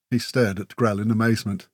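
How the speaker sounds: plain reading voice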